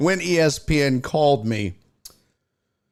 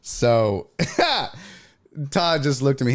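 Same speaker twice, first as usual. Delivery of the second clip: normal talking voice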